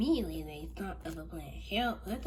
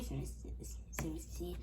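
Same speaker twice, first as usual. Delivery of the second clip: ordinary delivery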